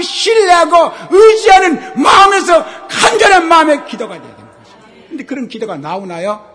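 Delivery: soaring shouted sermon; full-force preaching. Acted. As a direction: cry out for earnest prayer. Preacher exhorting on prayer.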